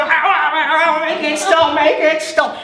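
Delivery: high squealing ow, laughing through it; pained demon. Acted. Demon banged by rocking chair.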